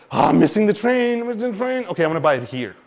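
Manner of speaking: fear of missing out. Retold or imitated. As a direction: blurt it like the train is leaving. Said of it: "fear of missing out".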